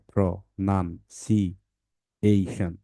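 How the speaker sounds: Pronunciation split slowly syllable by syllable; broken into syllables. Shown as a demonstration. Teacher shows parents how to break the word, very slowly. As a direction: stretch pronunciation out into its five parts. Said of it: "এটা ভাঙতে হয় এভাবে".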